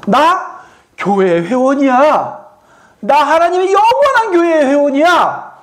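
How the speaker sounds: high, drawn-out proud cry; confident proclamation. Acted. Church member declaring membership to the world, confident proclamation. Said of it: "당당하게 외치실 수 있어야 돼요".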